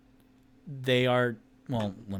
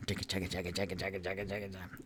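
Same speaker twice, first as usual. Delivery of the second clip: rhythmic chant; 4 semitones lower, 10 dB quieter, much faster than usual. Acted. Gazer train rhythm sound.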